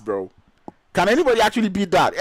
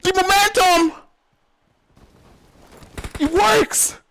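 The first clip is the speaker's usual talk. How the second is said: excited commentary, climbing higher to a yelled climax; live play-by-play; 10 semitones higher, faster than usual. Real, not acted. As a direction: build the call up until it bursts, faster and higher. Caster's live play-by-play call.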